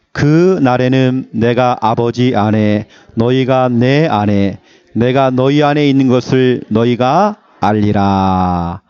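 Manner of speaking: slow, solemn reading of scripture. Acted. Pastor reading the verse aloud to the church, slow and solemn. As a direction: read the verse slowly to the congregation.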